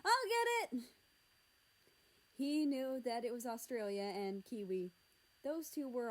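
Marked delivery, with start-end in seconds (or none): 0.1-0.8 high, loud eager shout; yell. A narrator's reading. Children racing to answer the door, yelling. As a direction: holler it out at high pitch. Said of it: "he heard two voices yell".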